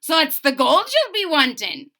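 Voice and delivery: sharp suspicious leprechaun. A narrator's reading. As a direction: snap it at him in a tiny man's voice. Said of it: "declared the leprechaun sharply".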